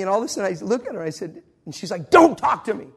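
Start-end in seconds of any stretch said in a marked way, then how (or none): 2.1-2.9 higher, louder imitation of snapping; mock scream. Older lady rebuffing traveler, mock angry yell. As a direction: shut the listener down with a forceful outburst. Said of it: "she's screaming at me".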